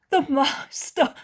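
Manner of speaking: high, loud cry of recognition; exclaiming. A narrator's reading. Woman greets Jesus on seeing him arrive, exclaiming. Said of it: "exclaims the woman".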